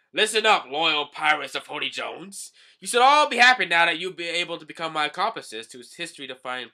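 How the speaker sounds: high, loud villain's speech; booming theatrical announcement; loud proclamation. Acted. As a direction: address a crew of pirates like a grand villain. Vanderdecken addresses assembled pirates.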